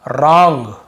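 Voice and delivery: "रॉन्ग" starting on a plain R, loud and clear; silent W. Shown as a demonstration. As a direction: begin "रॉन्ग" straight on R with no W. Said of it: "डब्ल्यू को नहीं पढ़ना". ("रॉन्ग", डब्ल्यू silent, ज़ोर से)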